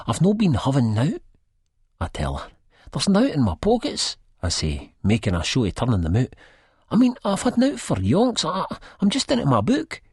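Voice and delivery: put-on insisting to his girlfriend he hasn't been using; defensive denial. A narrator's reading. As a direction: protest your innocence too hard.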